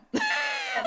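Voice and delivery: a sudden squealing laugh, high up; high burst of laughter. Real, not acted. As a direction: shriek of laughter at herself. Laughing at forgetting title.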